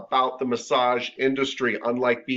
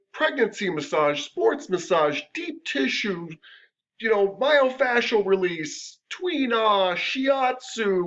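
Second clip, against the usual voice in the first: listing each specialty ever higher, mocking an overstuffed card; mocking long list; 10 semitones higher, much slower than usual. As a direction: pile on the titles, climbing higher each time. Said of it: "Some massage therapists are like".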